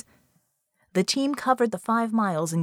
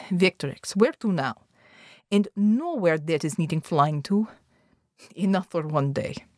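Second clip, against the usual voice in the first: put-on accented leader, curtly displeased; foreign-accented team leader. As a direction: play an accented leader calling it a day. Narrator voices Molotok, team leader.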